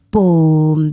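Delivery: stomp it out like a giant's step; booming footsteps. A narrator's reading.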